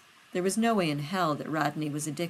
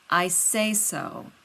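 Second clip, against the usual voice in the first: slow, firm and final; 4 dB louder, much slower than usual. A narrator's reading. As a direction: plant your foot, end it. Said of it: "John said firmly".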